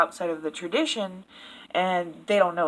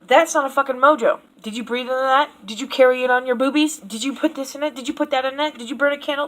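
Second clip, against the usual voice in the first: hammed-up angry grilling, higher and louder; mock ranting accuser; 5 semitones higher, 5 dB louder, faster than usual. Imitating a critic attacking a beginner, hammed-up rant. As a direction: fire off accusing questions like a self-righteous critic. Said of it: "me coming at you and being like".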